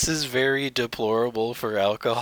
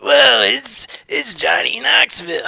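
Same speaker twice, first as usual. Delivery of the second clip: high, loud excited Johnny Knoxville; Johnny Knoxville impression; 9 semitones higher, 5 dB louder than usual. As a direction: yell it out high and bright. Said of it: "what does Johnny Knoxville sound like".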